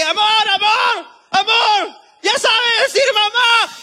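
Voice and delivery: high, slow, excited shout across the house. Retold or imitated. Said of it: "Entonces grité".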